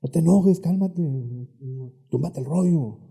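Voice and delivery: put-on a parent snapping at an angry child; mock scolding. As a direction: scold like someone telling you to calm down. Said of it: "Nos han enseñado".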